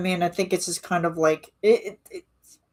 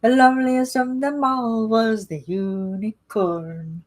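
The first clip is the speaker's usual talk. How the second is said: put-on high, slow singsong Irish accent; terrible Irish accent; 5 semitones higher, slower than usual. Streamer sings Irish Rovers lyric in Irish accent. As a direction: lilt it out in a hammy Irish accent. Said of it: "Sorry for the terrible Irish accent".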